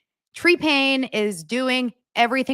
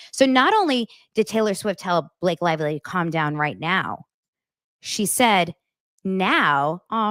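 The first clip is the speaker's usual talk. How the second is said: ordinary talk, nothing marked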